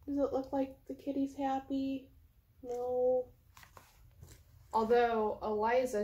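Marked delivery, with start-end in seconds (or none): none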